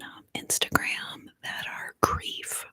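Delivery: ordinary talk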